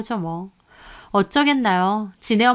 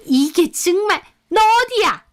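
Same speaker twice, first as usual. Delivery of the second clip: much higher, louder sputtering rage; furious mother-in-law; 9 semitones higher, 4 dB louder than usual. A narrator's reading. Mother-in-law threatened with lawsuit, furious high demand. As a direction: demand where she is, beside yourself.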